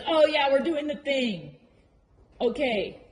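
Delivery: lightly voiced horse; voicing the horse. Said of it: "And he goes".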